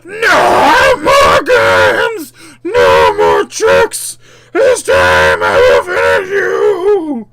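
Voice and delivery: huge, slow shrieking monster threat; full-force, drawn-out demonic rage; demon scream. Acted. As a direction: scream each phrase in a demon voice. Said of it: "The spaghetti demon scream".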